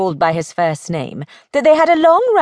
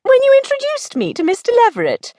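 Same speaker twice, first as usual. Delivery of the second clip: high, quick, pert comeback; faster than usual. Acted. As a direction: finish the retort pertly and fast. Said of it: "she pointed out pertly".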